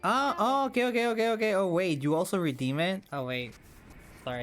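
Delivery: ordinary delivery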